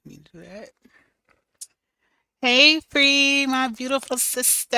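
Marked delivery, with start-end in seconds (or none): 2.4-4.8 high, loud burst of welcome; light up and call out her name; excited greeting. Real, not acted. Calling out to her friend Free, thrilled and loud.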